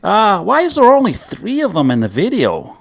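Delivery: overexcited fake Canadian YouTuber; mock American accent. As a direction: ham up a gushing North American reactor. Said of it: "terrible American accent".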